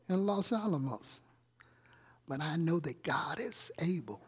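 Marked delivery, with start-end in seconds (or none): 2.3-4.2 whispered affirmation of faith. Real, not acted. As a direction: hush it as a quiet confession.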